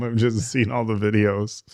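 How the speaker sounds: ordinary delivery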